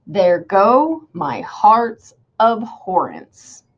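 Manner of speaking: "There go my heart's abhorrence" in slow, swooping metrical beats; iambic scansion. Shown as a demonstration. Teacher putting it all together, slow exaggerated meter. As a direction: stretch "go", "heart's" and "abhorrence" beat by beat. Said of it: "my syllables sound unstressed".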